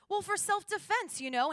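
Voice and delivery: high-pitched, sheepish justification; defensive excuse. Retold or imitated. Traveler explaining the knife to officer, high defensive squeak. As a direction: justify yourself, pitch shooting up.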